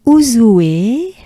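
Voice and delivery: Ouzoue linked and rising at the end; unfinished rising tune. Shown as a demonstration. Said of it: "say it like this 'cause I'm not done".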